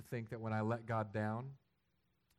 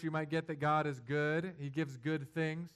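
normal talking voice

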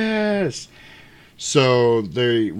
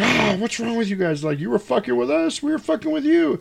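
higher, exasperated complaint; mock indignant woman; 13 semitones higher than usual. Woman scolding pranksters in a high mock voice. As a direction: play her fed-up and squeaky.

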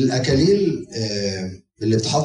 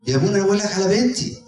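exasperated mother; 7 semitones higher, faster than usual. Retold or imitated. Worried mother keeps interrupting priest.

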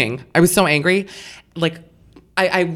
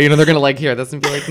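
ordinary delivery

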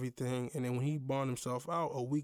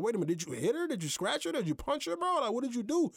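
questions climbing higher and higher; alarmed interrogation; 11 semitones higher, faster than usual. Retold or imitated. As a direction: press him, rising in disbelief. Retold rapid-fire questioning.

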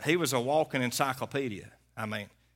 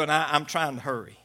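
ordinary delivery

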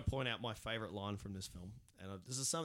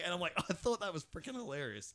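usual speaking voice

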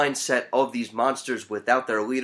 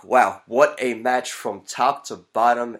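ordinary delivery

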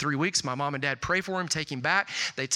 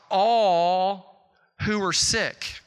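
"all" drawn out very long and higher; stress on all; 4 semitones higher, 4 dB louder, much slower than usual. Real, not acted. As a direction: hit "all" hard and stretch it out slowly. Preacher answers own question "healed who?.

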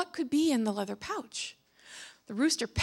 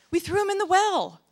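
protesting; 7 semitones higher, 3 dB louder than usual. Acted. Guards protest to sultan.

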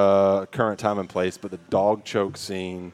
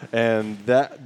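ordinary talk

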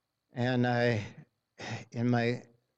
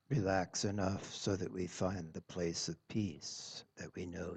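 soft spa whisper; 3 semitones lower, 4 dB quieter than usual. Acted. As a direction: ease them gently into stillness. Leads a guided meditation.

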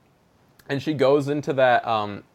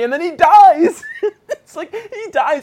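high, loud laugh-crying through the words; cracking up in mock anguish; laugh-sob at a cruel plot twist; 11 dB louder than usual. Real, not acted.

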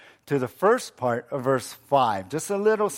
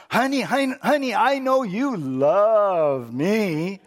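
excited wife. Acted. Wife's third dream, most excited.

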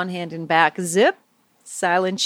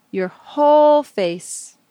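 "whole" stretched long, higher and louder; stress on whole; 7 semitones higher, 6 dB louder, slower than usual. Acted. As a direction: draw out "whole" on a high, held note. Guiding warmth over whole face.

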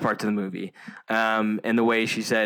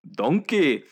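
"donkey" with rolled Scottish vowel, higher; 6 semitones higher, 3 dB louder than usual. Shown as a demonstration. Showing friends how Shrek says "donkey", Scottish accent. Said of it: "you have to say donk".

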